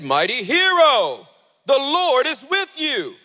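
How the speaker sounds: loud, high, slow heralding voice; angel's proclamation. Acted. Angel of the Lord greeting Gideon, grand proclamation. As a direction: boom out a grand heavenly greeting.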